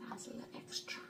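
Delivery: whispered confession, playful. Pretend. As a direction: breathe it like a secret. Confessing aside to viewers.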